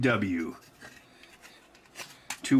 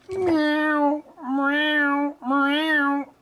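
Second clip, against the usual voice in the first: much higher, louder meowing like a cat; high cat meows; 14 semitones higher, 4 dB louder, much slower than usual. Pretend. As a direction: meow three times like a kitten. Imitating the cat.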